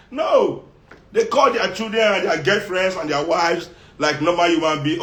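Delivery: ordinary delivery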